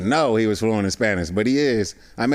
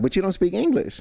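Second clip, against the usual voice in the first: voicing Chilean girl; 8 semitones higher than usual. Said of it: "I would do my voice as the girl".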